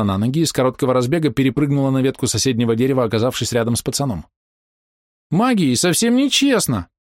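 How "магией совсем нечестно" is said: put-on high, slow squeaky offended girl. A narrator's reading. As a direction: whine it in a piping little voice. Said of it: "обиженно писклявым голосом заявила она". (обиженный писклявый голос)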